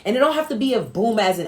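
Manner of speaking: normal talking voice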